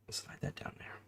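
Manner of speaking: soft unvoiced whisper to herself. Real, not acted. Fitting a model part, whispering the step. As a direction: murmur the step without voice. Muttered building instruction.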